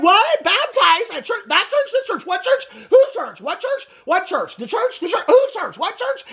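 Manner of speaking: squeaky, very high, rapid-fire disbelief; hammed-up panic, every question shooting upward; mock freak-out. Recapping Robin hearing about the church, mock freak-out. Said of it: "freaking out".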